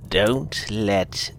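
very slow, soft hoarse whisper; whispered warning, very slow. Acted. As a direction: lean in and rasp it. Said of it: "said with a hoarse whisper".

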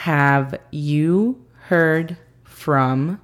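very slow careful English repeat; slow repeat for learners. Shown as a demonstration. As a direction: say it again slowly and clearly. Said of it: "La segunda frase del día es".

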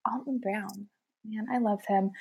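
whispered, almost no voice; hushed fan whisper; awed whisper. Real, not acted. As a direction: breathe it like a fan's secret. Gushing aside about a hero.

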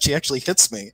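ordinary delivery

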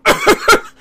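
a loud, exaggerated hacking cough; mock a careless cougher's big cough; imitated open cough. Mocking boomers who cough openly, a loud fake cough. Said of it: "how many fucking boomers go".

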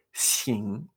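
xin held low in a slow third tone. Shown as a demonstration. Teacher names the third tone, xin low and drawn out. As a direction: let xin dip low and linger.

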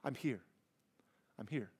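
soft, fading hush of reassurance; soothing a panicked child. Retold or imitated. Parent to a panicked child, soothing and fading to a hush. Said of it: "And they're looking around like".

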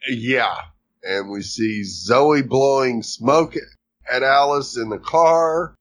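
ordinary talk

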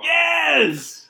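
loud, high triumphant shout; exultant yell; excited cheer. Real, not acted. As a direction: cheer it out at full voice. Cheering the violent scene.

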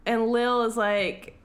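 ordinary talk, nothing marked

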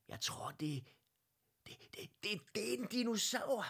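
rising, stuttering in fright; frightened stammer. A narrator's reading. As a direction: stammer out the word dinosaur. Stammering fear at dinosaur.

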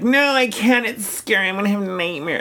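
high, whiny mock-scared child; cowardly kid. Kid refusing older brother's horror movie, mock terror. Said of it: "be a complete coward".

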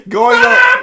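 high and loud, shouted; hype shout. Acted. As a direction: belt it out like a fanfare. Laughing hype restart.

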